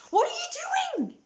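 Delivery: very high-pitched incredulous squawk; exasperated outburst shooting way up in pitch; exasperated disbelief. Retold or imitated. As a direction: cry out in high, appalled disbelief. Acts out own exasperated reaction.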